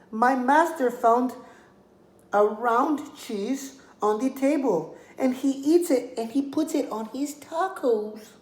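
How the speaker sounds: high, pleading little lamb voice; a small lamb talking her way out of danger. Acted. Lamb pleads with the hungry coyote, high and sweet.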